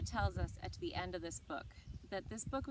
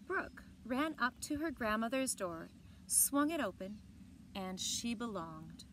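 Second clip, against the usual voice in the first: put-on reading a picture book aloud to children; bright, clear read-aloud voice; story narration; 3 semitones higher than usual. A narrator's reading. Teacher reading a book to the class, in a storytelling voice.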